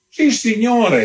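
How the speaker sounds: high-pitched countess exclamation; exclaiming. A narrator's reading. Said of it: "esclamò la contessa".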